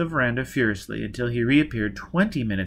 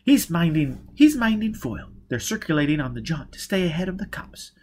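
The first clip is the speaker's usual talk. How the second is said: higher-pitched woman character recounting her escape; higher voice, story told to an accomplice; Jiz, hard-edged escapee; 5 semitones higher than usual. Acted. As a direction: tell the escape in a raised character voice. Voices Jiz continuing her account.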